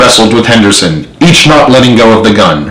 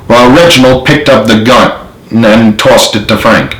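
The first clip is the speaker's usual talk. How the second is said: ordinary delivery, nothing marked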